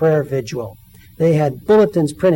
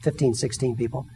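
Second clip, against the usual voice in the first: ordinary delivery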